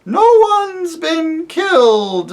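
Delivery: high, slow wailing hoot. Acted. Owl to the deaf dwarf, high wailing hoot. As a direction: hoot it out with a cry in it.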